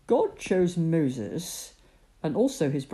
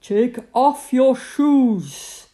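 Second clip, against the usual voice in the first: voice from the bush; 7 semitones higher, 10 dB louder, slower than usual. Acted. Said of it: "came a voice from the bush".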